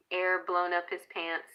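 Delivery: ordinary talk, nothing marked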